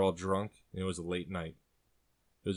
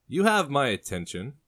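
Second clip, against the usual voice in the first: high, loud barkeep perking up; interested barkeep; 7 semitones higher, 6 dB louder than usual. Acted. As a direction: show sudden interest, pitched up.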